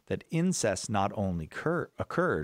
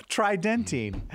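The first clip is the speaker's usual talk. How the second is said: laughing slogan voice; 10 semitones higher, 3 dB louder, slower than usual. Pretend. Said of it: "sounds like an advertising slogan".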